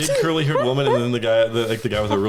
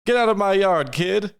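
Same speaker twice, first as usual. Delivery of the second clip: high, raised holler across a yard; calling out; 9 semitones higher than usual. Acted. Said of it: "the person that he was calling out to".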